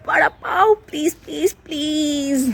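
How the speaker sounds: high, whiny repeated plea; a child nagging for food; begging kid. A narrator's reading. Putti begging his parents, high and whiny.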